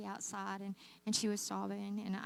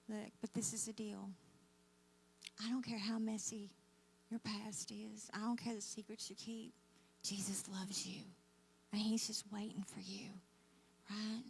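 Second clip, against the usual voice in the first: intimate whisper; 4 dB quieter than usual. Acted. Preacher whispering to the congregation about Jesus' love, hushed. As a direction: lean in and whisper it like a secret.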